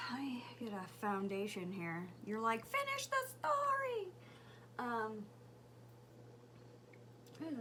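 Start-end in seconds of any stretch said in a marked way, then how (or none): 2.7-4.1 high-pitched imitated viewer nagging; mock impatient demand.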